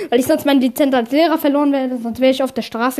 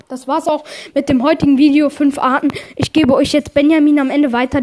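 normal talking voice

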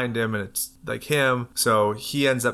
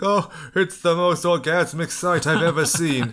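awestruck monster; 7 semitones higher, 3 dB louder than usual. Retold or imitated. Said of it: "He's like".

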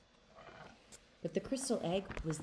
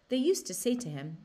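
ordinary delivery, nothing marked

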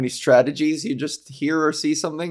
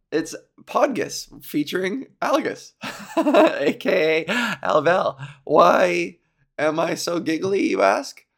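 ordinary talk

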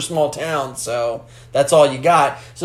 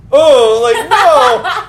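high, loud mock horror, like recoiling from a grope; mock horrified gasp; 9 semitones higher, 7 dB louder, much slower than usual. Imitating Morgan reacting to the grope with high horrified squeals. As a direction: squeal out a disgusted refusal. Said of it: "Morgan's face is like".

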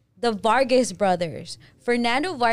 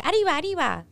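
mock cartoon Mexican; 8 semitones higher, faster than usual. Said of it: "they think all we do is say".

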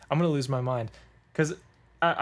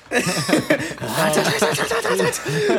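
high shrieking laugh; 6 dB louder than usual. Real, not acted. Podcaster laughing at own hot take, high shrieking laugh. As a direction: burst of squealing laughter, much higher and louder.

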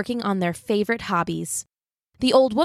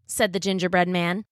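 usual speaking voice

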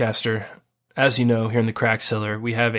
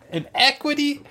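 surprised. Real, not acted. Incredulous repeat of rating.

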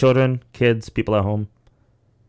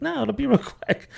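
high-pitched mock doctor voice; imitated breezy doctor, pitched way up; dismissive reassurance. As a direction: brush off the worry in a high, airy pitch. Retold doctor brushing off Novocaine request.

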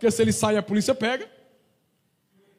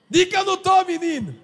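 loud hiding order; 5 semitones higher, 5 dB louder than usual. Retold or imitated. Voicing someone hiding at door.

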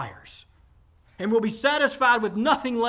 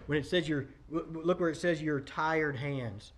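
normal talking voice

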